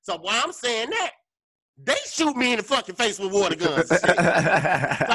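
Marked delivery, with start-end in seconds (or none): none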